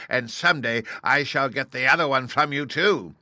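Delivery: high, loud witch gloating; taunting witch. A narrator's reading. Witch taunting Dorothy, high and loud. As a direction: threaten her with a sneer. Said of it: "laughing at her".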